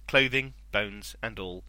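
ordinary delivery

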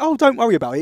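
a hammed-up cheery nurse to a worried dad; chirpy midwife. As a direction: play the chirpy nurse brushing it off.

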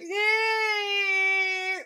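long, high siren shriek; imitated alarm siren; tornado alarm wail. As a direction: wail "ehhh" on one held, piercing note like an alarm going off. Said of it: "turn on their internal tornado alarm".

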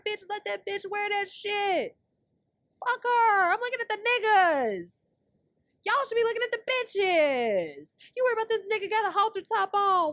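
mock-high diva rant; high sassy rant. Real, not acted. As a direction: screech at them sassily. Sassy rant, much higher, loud.

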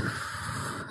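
long unsteady breath out, close to tears; trembling exhale to calm nerves; shaky breath out. Real, not acted. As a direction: let the breath shake out before going on. Steadying herself before speaking.

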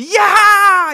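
sudden high, loud triumphant yell; mock cheer. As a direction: cheer like the crowd at the big finale. Imagined triumphant ending celebrated.

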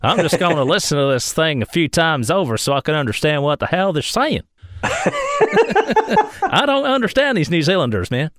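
lightly put-on American accent; puzzled American listener voice. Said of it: "American listener confused".

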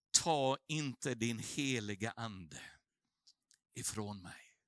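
slow, low and soft; hushed prayer. Retold or imitated. Pastor voicing David begging God, slow, soft and low. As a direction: plead slowly, fading out.

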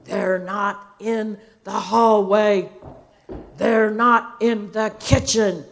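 loud, slow mock searching; deep, grumbly voice. Dad calls out room by room for hiding children, loud grumbly voice. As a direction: announce each empty room like a hunting dad. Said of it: "deep, grumbly voice".

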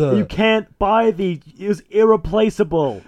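high, very slow anguished protest; mock freak-out. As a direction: wail it in exaggerated despair. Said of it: "he's freaking out a bit more".

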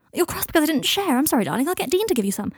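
high-pitched breezy patter; airy, false apology. Acted. Draco deflecting Michael's anger, airy and false.

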